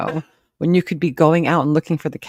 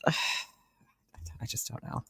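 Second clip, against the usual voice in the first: whispered; 5 semitones lower, 18 dB quieter, much slower than usual. Real, not acted. Sighing, exasperated over the story.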